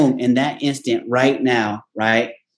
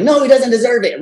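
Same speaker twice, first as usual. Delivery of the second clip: mother refuses, voiced higher; mom's voice; 8 semitones higher than usual. Mom overrules the coach about the jersey, firm and higher. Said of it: "My mom's like".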